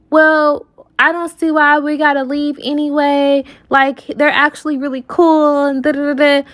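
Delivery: high, breezy mock friend voice; lightly voiced friend wanting to stay; imitating the friend's carefree tone; mimicking friend.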